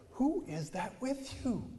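put-on high, slow, curious old-lady voice; mock old-lady question; older lady. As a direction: ask it like a nosy older woman. Older ladies voiced in story.

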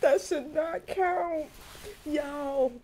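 high, slow, drawn-out whine; whining protest. Real, not acted. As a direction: plead against it in a high wail. Burped early, protesting the failed challenge.

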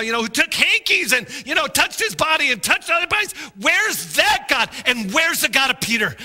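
crying out to God. Retold or imitated. Preacher retelling his prayer, crying out. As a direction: call out to God in desperation. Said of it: "I'm just crying out to God".

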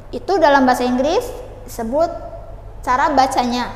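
normal talking voice

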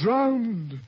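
high, loud stunned cry; shocked outcry. Acted. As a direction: cry out in disbelief. Laertes hears of sister's death.

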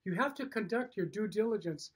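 normal talking voice

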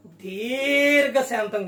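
slow దీర్ఘశాంతం with the opening "dee" drawn out; long first vowel. Shown as a demonstration. Preacher answering own question on what to have, దీర్ఘశాంతం with stretched "dee". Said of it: "పైన కొమ్ము ఉందా?".

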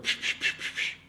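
voiceless "psch" bursts in quick series; imitated shooing hiss. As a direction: re-enact shooing off a scorpion. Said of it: "dann habe ich nur gesagt". (nachgemachtes Zischen zum Verscheuchen)

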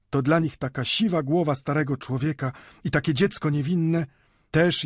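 hard, ringing voice. A narrator's reading. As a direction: speak out firmly, pitch lifted, ringing like a bell. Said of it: "mówił twardym, brzmiącym jak dzwon głosem".

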